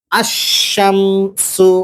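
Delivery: আশ-শামসু very slow, with শ doubled and the লাম silent; slow doubled shin. Shown as a demonstration. Teacher modelling the shamsi reading for students, very slowly. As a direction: draw out আশ-শামসু, leaning on the doubled শ. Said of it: "শিনের সঙ্গে তাসদিদ দিয়ে পড়তে হবে".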